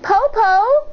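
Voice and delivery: put-on high little girl asking; high child voice; child's voice. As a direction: call like a small child. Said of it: "Shang asked".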